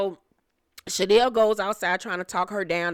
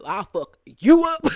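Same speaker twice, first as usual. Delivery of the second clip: mock threat; 3 semitones higher, 7 dB louder than usual. Mimics the threat, then laughs.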